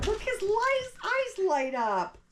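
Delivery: high and loud, delighted; excited. Real, not acted. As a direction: squeal it out with delight. Excited over lit-up eyes.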